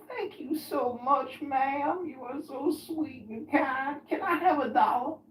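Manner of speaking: butter her up before asking for money; old man grateful to the girlfriend, high and sweet; sweet-talking old man. Retold or imitated. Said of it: "he said".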